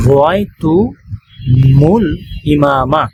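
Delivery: very slow রয়তুমুল with the মিম carrying dhamma; teacher drawing out the joined form for the class; joined Arabic reading. Shown as a demonstration. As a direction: stretch রয়তুমুল out so the linking মু is clear. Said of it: "তাহলে মিলিয়ে পড়লে".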